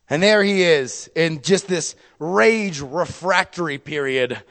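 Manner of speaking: normal talking voice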